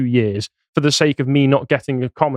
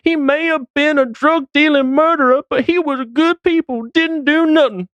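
high, loose American street accent impression; hammed-up mixed American accent, pitched way up; 12 semitones higher than usual. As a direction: caricature a US news interviewee in a muddled accent. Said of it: "That was a mix of accents".

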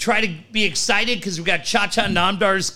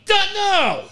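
much higher irritated snap; snapping; 14 semitones higher than usual. Pretend. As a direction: snap it at the wife. Paul snapping at wife.

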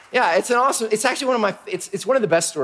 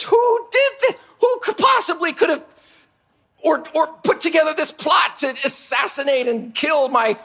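outraged king; 9 semitones higher than usual. Acted. The king demands who plotted against his queen, shouting high. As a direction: roar at whoever dared do this.

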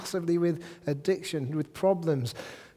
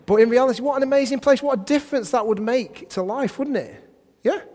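much higher and louder, rushing fast; rising excitement; 9 semitones higher, 7 dB louder than usual. Real, not acted. Preacher urges the congregation, excited and racing. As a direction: burst out with wonder.

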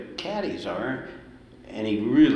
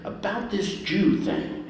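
mock delicate official; 10 semitones higher, slower than usual. Chairman's loaded phrase.

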